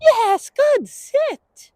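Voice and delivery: drawn-out yes, high praise; praise for dog. Real, not acted. Trainer rewarding dog's stop, slow high praise.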